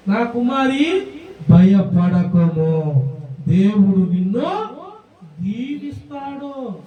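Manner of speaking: very slow, low, measured pronouncement; solemn blessing. Acted. Boaz speaks to Ruth, solemn slow reassurance. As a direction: stretch out each word like a grave blessing.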